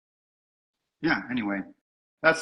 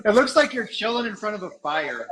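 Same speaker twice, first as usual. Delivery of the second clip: laughing; 9 semitones higher than usual. Real, not acted. Laughing at the giant pint.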